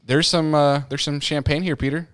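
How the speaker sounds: lightly put-on Southern belle offering champagne; southern accent. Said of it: "with her southern voice".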